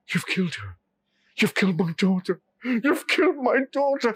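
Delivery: grief building into a wail; old man's voice rising in anguish; grieving cry. Acted. Old man finds daughter dead.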